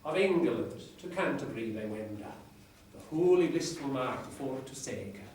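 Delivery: reading Chaucer aloud in Middle English pronunciation. Shown as a demonstration. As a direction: recite it like a lecturer reviving Chaucer's English. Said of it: "I can't pronounce it as well as my lecturer in Middle English, but I'll give it a go".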